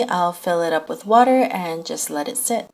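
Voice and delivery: ordinary talk, nothing marked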